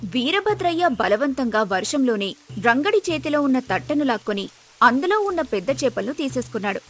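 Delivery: ordinary delivery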